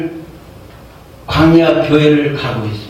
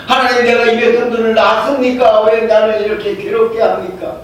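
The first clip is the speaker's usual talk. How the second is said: complaining to God; 7 semitones higher than usual. Retold or imitated. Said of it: "때로는 원망".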